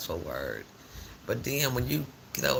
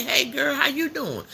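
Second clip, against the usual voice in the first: higher, louder happy hello; bubbly greeting; 12 semitones higher, 3 dB louder than usual. Retold or imitated. Mimicking how her girlfriend usually greets her, bubbly. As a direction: imitate a cheerful friend's hello. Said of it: "she usually all happy".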